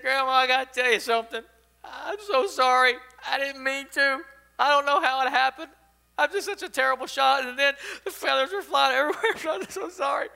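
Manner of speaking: high, loud, acted tearful wail; weeping. A narrator's reading. Little boy confesses to his grandmother, mock weeping. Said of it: "He just starts weeping".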